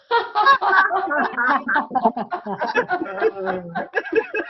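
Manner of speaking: very high wide-armed laughing; meter laughter. Shown as a demonstration. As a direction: laugh with arms spread wide. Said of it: "open your arm up wide and laugh".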